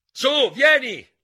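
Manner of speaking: high, slow, very loud stamping yell. Acted. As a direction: yell it out, stamping your feet. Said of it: "urlò pestando i piedi". (urlo acuto e lento, molto forte)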